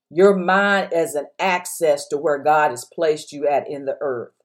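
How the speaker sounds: ordinary delivery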